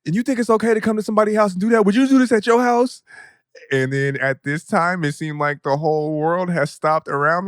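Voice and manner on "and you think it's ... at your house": fast, higher mock tirade; imitating the furious mom, fast and pitched up; mom going off. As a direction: rattle off the scolding like his angry mom. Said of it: "and then she was like".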